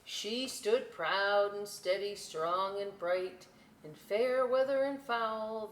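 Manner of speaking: slow, proud storybook reading; story narration. A narrator's reading. Reading aloud to children, slow and proud. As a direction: read it grand and steady.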